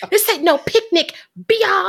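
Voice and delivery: hammed-up movie catchphrase; Taye Diggs impression. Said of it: "Taye Diggs in Malibu's Most Wanted".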